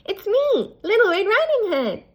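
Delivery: put-on high, bright child's voice; a cheerful little girl. A narrator's reading. Girl answers at the door.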